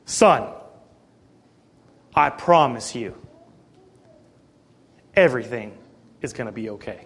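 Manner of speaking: father quietly calming his son; calm reassurance. Retold or imitated. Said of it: "in a very calm voice".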